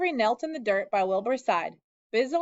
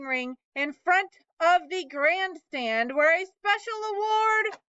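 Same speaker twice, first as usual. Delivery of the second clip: higher, slow, grand fair announcer; pompous loudspeaker; 7 semitones higher, 3 dB louder, slower than usual. A narrator's reading. Loudspeaker announcing the pig to the fair crowd, pompous and slow. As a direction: announce it like the fair's proud loudspeaker. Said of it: "said the loudspeaker in a pompous voice".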